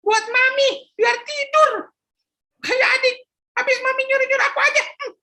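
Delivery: put-on high childish teasing voice; cheeky boy. Philip cheekily answering his mother, high teasing child voice. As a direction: tease mum like a cheeky kid.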